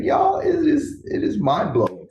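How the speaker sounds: shoots up high and loud with real delight; can't contain the excitement, cry out to the audience; a burst of joy, pitched high and loud; excited outburst. Real, not acted. Gushing about helping people for a living.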